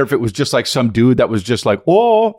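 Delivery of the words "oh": put-on Minnesota accent. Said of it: "from Fargo".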